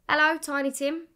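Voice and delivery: loud, high, cheeky greeting; cocky. Retold or imitated. Cocky singer greeting the cameraman at her audition, cheeky. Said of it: "really cocky at this point".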